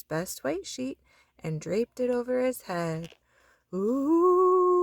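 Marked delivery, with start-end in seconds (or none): 3.7-4.8 high, loud spooky ghost moan; haunt the room with a long ooh. A narrator's reading. Froggy in his sheet playing a ghost, spooky moan.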